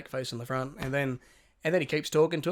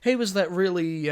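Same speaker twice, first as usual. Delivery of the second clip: much higher, animated exclamation; imitated recognition; 5 semitones higher, 4 dB louder than usual. Leo realising he has seen that man, excited recognition. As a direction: leap up in pitch as you recognise him.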